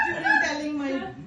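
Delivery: words through a laugh; laughing while talking. Real, not acted. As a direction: let the laugh ride the line.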